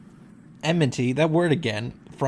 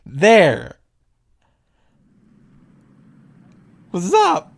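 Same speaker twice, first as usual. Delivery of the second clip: loud call; 3 semitones higher, 8 dB louder, much slower than usual. Acted. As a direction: call out to whoever grabbed you. Hero spins round, calls out.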